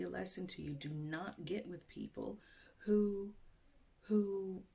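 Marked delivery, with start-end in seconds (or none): none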